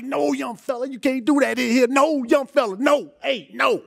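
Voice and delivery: high, loud, urgent settling voice; mock calming down. [name] telling the young player to stop, loud.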